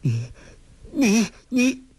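stammering, rising to a high shock; a villain stunned by who stands before her. A narrator's reading. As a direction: choke on the word in disbelief. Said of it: "更加吃惊".

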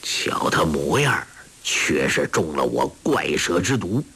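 put-on low, hushed voice of a scheming old master; sinister old villain, puzzled. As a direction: purr low and menacing with gloating pleasure. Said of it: "欧阳锋奇道". (阴沉低哑的老魔头口吻)

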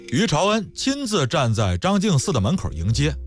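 ordinary delivery, nothing marked

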